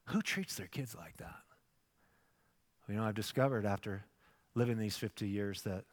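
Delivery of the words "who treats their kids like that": bewildered. Retold or imitated. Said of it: "I was just like".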